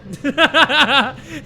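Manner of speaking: high, loud laugh; burst of laughter. Real, not acted. Laughing at his dog's imagined warning, high and loud. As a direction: let out a real laugh at the joke. Laughing at own dog joke.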